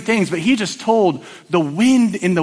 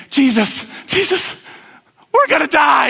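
high-pitched urgent cry, acted out; panicked disciples; 7 semitones higher than usual. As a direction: act out terrified sailors waking their master. Disciples panicking, waking Jesus in storm.

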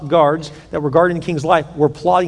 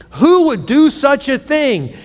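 high, slow, outraged question; king's indignant challenge, each word stretched; angry demand; 8 semitones higher, slower than usual. Acted. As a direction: demand it with raised pitch. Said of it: "the king gets angry".

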